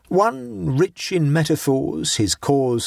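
measured, slow poem reading; slow recited verse. A narrator's reading. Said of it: "he murmured in his beard".

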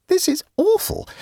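very high, dismayed exclamation; high-voiced woman aghast; alarmed. A narrator's reading. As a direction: cry out the words in high-pitched alarm. Jane alarmed at the threat.

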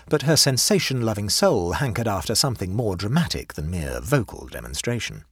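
ordinary delivery, nothing marked

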